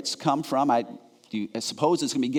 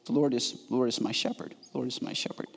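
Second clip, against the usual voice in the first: quiet psalm; 4 semitones lower, 7 dB quieter than usual. Retold or imitated. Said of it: "focusing on the truth of Psalm twenty-three".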